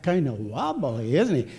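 slow cartoon lilt, lifting at the end; Thumper. Visitor quoting Bambi to Jack, playful rabbit impression. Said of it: "for talking like that".

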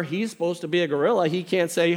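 usual speaking voice

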